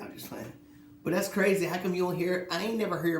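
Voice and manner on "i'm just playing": whispered, much softer; breathy whispered aside to the listener. Real, not acted. Repeats the teasing aside to the audience in a whisper.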